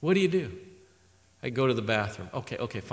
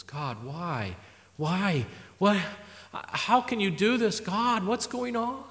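anguished plea; 4 semitones higher, 4 dB quieter, slower than usual. Retold or imitated. Retold anguished cry to God.

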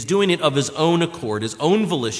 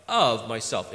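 weight on "of myself" without raising the voice; emphatic; 6 semitones lower, 4 dB quieter, slower than usual. Retold or imitated. Preacher rereading Jesus's words to the congregation, understated emphasis. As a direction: lean firmly but quietly on "of myself".

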